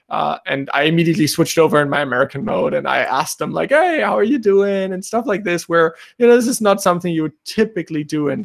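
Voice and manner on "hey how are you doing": ordinary talk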